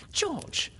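high-pitched reproachful cry; pained exclamation. A narrator's reading. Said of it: "Missus Darling exclaimed, pained".

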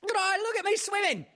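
very high, excited squeak of a little kangaroo; squeaky Roo. A narrator's reading. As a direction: squeal it out like a proud little joey. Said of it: "squeaked Roo".

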